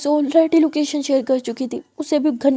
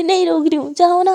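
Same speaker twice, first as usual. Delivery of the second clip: louder, higher petulant retort; defiant sulk; 4 semitones higher, 6 dB louder than usual. A narrator's reading. Sulking girl refuses to stop the carer leaving, defiant. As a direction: snap back that she can go.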